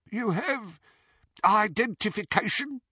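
high, questioning goblin voice; wary goblin. Acted. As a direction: ask it warily, high-pitched.

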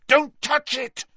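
high, sharp warning cry; urgent warning. A narrator's reading. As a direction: stop him before he touches it. Harry urgently stops Ron touching it.

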